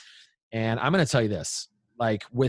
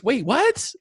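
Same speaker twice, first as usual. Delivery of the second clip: high, rising mock disbelief; retold bafflement; 14 semitones higher, 4 dB louder than usual. Dad hearing his daughter's accusation, retold baffled surprise. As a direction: re-enact being caught off guard.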